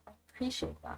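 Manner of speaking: "I appreciate that" whispered, no voice; a whispered "I appreciate that" to the viewer; whispered thanks. Real, not acted. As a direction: breathe "I appreciate that" as a soft whisper. Thanking a chat viewer, repeated.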